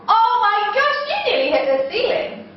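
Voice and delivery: high, excited mock amazement; amazed praise. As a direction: gush at the children's huge jump. Praising children's big jump.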